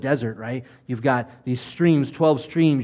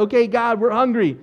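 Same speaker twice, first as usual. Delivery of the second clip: higher-pitched mock plea; crying out; 8 semitones higher than usual. Hungry Israelites crying out to God for food, pleading. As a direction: call up to God in complaint. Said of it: "we cried out".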